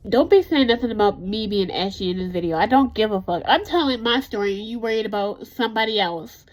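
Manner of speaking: loud, fed-up clapback at haters; defiant and loud. Real, not acted. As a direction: snap at the critics, loud and sharp. Defiant rant at viewers' comments.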